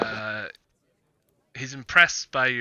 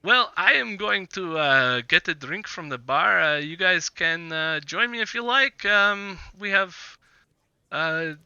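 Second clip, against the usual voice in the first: higher, lilting character voice; higher voice with hesitant fillers; tavern character, easygoing; 5 semitones higher, 3 dB louder than usual. Acted. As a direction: play a sociable host offering company, pitched up. Game master plays Ergel.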